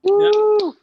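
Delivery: big high cheering whoop; loud whoop. Real, not acted. Host whooping for his own introduction, shrill cheer. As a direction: whoop like a hype man.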